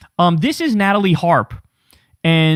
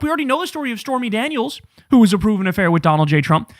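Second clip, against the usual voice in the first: ordinary talk, nothing marked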